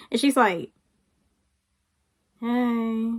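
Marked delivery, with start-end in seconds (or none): 2.4-3.1 softer, unenthusiastic imitation; reluctant greeting. Friend not wanting to talk to mom, reluctant. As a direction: give a grudging little hey. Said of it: "she didn't wanna talk to her".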